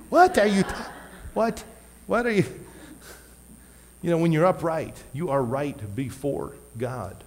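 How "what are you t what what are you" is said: mock confused kid. Kid's baffled reply.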